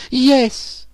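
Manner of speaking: high falsetto exclamation; theatrical high-voiced cry. A narrator's reading. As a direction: exclaim it as the wronged girl, very high. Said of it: "she cried".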